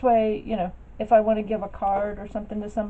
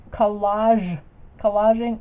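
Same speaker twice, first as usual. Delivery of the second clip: "Collage, collaging" repeated louder and spelled out clearly; 2 semitones higher, 7 dB louder, slower than usual. Retold or imitated. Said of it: "I never heard it said collaging".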